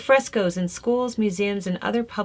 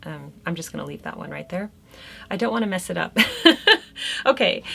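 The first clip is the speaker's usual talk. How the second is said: ordinary talk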